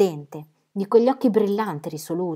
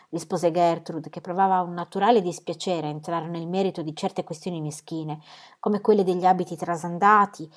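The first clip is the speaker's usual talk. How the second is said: ordinary delivery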